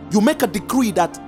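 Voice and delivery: normal talking voice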